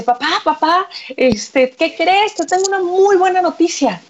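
high-pitched, excited son voice; acted euphoria. Son tells his father great news, acted euphoria. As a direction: burst in eagerly with good news. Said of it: "más bien eufórico con el papá".

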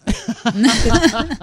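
short burst of laughter; quick, loud chuckle. Real, not acted. Amused reaction at scene start.

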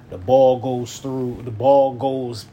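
a replay voice dragging the moment out; slow motion. Retold or imitated. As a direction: play it back in slow motion. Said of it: "like, in slow motion".

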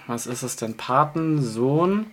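ordinary delivery

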